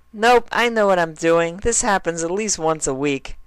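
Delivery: very high cheerful pony voice; bright and goofy, way up high; Derpy voice. Acted. Derpy answers Dash.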